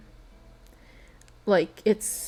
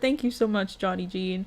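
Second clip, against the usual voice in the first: ordinary talk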